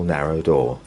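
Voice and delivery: ordinary delivery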